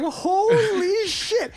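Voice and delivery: thrilled yell at the knight's intro; amazed shout. Retold or imitated. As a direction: stretch it out in awe. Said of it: "And I was like".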